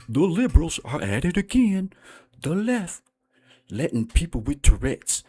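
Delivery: high, loud put-on pundit voice; mocking the YouTuber's voice. Comedian mimics the YouTuber he's mocking, high and loud. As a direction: ham up the YouTuber's preachy talk. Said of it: "he talks like this".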